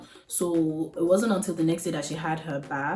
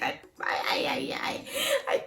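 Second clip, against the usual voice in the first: stammering, breathless whisper; choked whisper; 4 dB quieter than usual. Real, not acted. New mother overcome before the birth memory, whispered. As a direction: barely get the words out.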